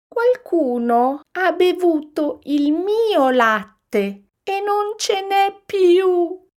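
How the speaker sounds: put-on very high, slow, loud baby-bear wail; little bear sobbing. A narrator's reading. Little bear finding his milk all gone, wailing. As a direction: wail it like a tiny upset cub. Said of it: "singhiozzò piccolo orso".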